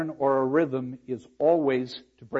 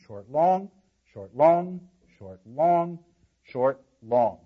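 "short" brief, "long" stretched out; evenly paired "short, long" with weight on "long"; iambic short-long rhythm; slower than usual. Shown as a demonstration. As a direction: chant the beat, drawing out each "long". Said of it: "we need five of these groupings".

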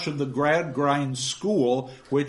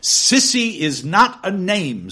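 stern correction; 4 semitones higher, 7 dB louder, slower than usual. Acted. Gradgrind corrects the girl.

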